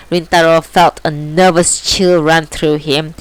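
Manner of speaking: plain reading voice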